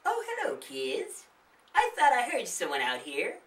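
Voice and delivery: high, chirpy granny voice; a friendly old lady greeting guests; old woman voice. Acted. The old woman greeting the kids, high and chirpy.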